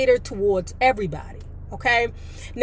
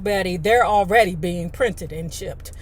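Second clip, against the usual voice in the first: ordinary delivery, nothing marked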